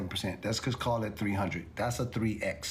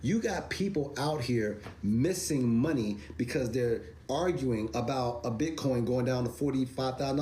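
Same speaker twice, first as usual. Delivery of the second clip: ordinary talk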